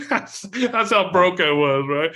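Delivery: high, loud and slow through laughter; laughing. Real, not acted. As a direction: laugh at yourself as you say it. Laughing at his own broke past.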